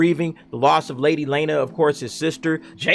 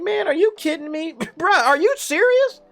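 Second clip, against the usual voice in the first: much higher, incredulous mock voice with a laugh; imitating Rhaenys's disbelief; 13 semitones higher than usual. Rhaenys rejecting Corlys's grandsons, high incredulous mock voice. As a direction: squeal it out like a shocked grandmother. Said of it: "Rhaenys is like".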